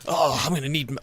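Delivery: hammed-up injured soldier, loud at first; mock crash-landing distress, imitating an over-committed role-player; all-in military character. Gamer imitating an open-mic player hurt on the roof, hammed-up military character. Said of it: "he just goes all in on the character".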